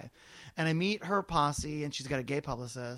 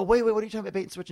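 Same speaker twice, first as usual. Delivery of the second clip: louder, higher startled plea; alarmed stop; 5 semitones higher, 4 dB louder, much faster than usual. Retold or imitated. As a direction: catch her before she leaves. Stopping guest walking out.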